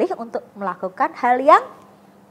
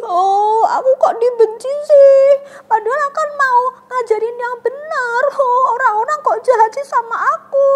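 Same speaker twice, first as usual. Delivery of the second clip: much higher, louder hammed-up crying voice; mock sobbing; 10 semitones higher, 8 dB louder than usual. Teacher imagines being hated, hammed-up crying. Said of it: "kakak pasti sudah sedih".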